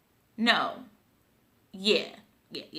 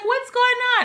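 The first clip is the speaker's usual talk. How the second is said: surprised coworkers; 14 semitones higher than usual. Retold or imitated. Coworkers surprised she's moving.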